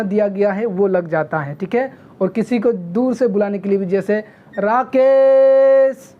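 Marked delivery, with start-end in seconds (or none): 4.6-6.1 Rakesh called loud with केश drawn out long; calling from afar. Shown as a demonstration. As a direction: shout the name as if far away. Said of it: "केस बहुत लंबा खींचा गया है". (दूर से पुकारते हुए, लंबा खींचकर राकेश)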